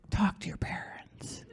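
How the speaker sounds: slow, near-whispered counsel; retold hushed advice. Friend's lawyer dad advising her, hushed and slow. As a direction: imitate someone advising very softly and slowly.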